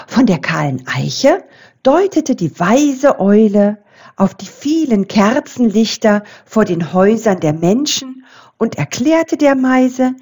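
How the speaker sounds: ordinary delivery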